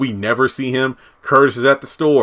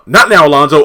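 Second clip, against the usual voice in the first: sharp, loud brush-off; mock scolding a bodyguard, loud; loud mock snap; 4 semitones higher, 10 dB louder than usual. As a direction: cut him off loudly. [name] snapping at Alonzo.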